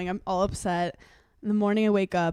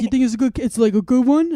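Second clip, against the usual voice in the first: louder eager mock question; imitating Aiden; 4 semitones higher, 6 dB louder, faster than usual. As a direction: press the question, keen and louder. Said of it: "He goes".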